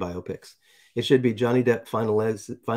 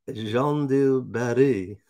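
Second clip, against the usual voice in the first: slow, drawn-out mock French accent; put-on French accent; much slower than usual. Host having fun with a film title, mock French accent. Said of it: "I don't really talk like that".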